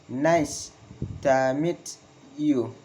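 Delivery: "Nice... to meet... you", broken up with pauses; falling intonation, word by word. Shown as a demonstration. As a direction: dictate it in pieces, dropping on "you". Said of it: "Falling intonation in statement".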